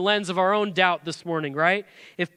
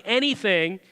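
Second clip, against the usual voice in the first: ordinary delivery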